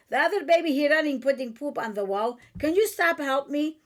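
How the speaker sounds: lively imitated Chaldean accent; mother's Chaldean accent. Mother modelling a request for help, in Chaldean accent. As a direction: keep up the Chaldean mother's broken English. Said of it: "And in her accent".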